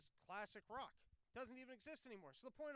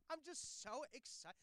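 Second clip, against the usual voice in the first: mock gushing excitement; 7 semitones higher than usual. Host mocking celebrity interviews in a drawn-out fake gush. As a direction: draw it out like an overexcited starlet. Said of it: "if I talk like this all the time".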